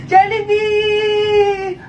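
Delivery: very slow, stretched out Jollibee; dreamy drawn-out. Acted. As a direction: savor the word dreamily. (mabagal at pinahabang "Jollibee")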